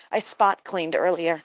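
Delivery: usual speaking voice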